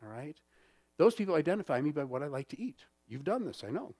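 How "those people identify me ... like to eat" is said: ordinary delivery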